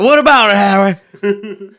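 high, loud hammed-up call; mock Queens accent. Host repeating his catchphrase to Howie, mock Queens accent. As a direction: bellow it in broad mock Queens. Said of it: "I said".

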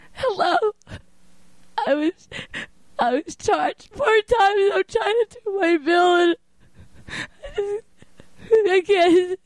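high-pitched, drawn-out sobbing plea; hammed-up tearful wail; fake crying. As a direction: pretend to cry down the phone, choking out the words. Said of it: "I'm gonna pretend I'm crying".

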